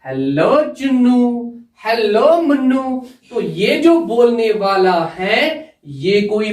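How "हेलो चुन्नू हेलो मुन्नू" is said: slow, drawn-out hellos; put-on character voice, slow and drawn out; Shanu greeting. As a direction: stretch each greeting out playfully as an acted character. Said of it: "ये शानू इसको बोलती".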